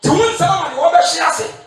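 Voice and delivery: fiery call and response; preaching call. Acted. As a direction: shout it out so the church answers.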